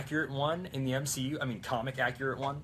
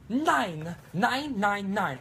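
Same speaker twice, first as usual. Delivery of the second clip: high, louder drawn-out "nine" for German nein; 8 semitones higher, 5 dB louder, much slower than usual. Pretend. The German girl refuses, saying nine for nein. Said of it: "German girl".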